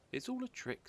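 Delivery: put-on high, scornful little child; child's voice. Gruffalo's child dismissing the story, high child voice. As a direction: scoff like a clever kid.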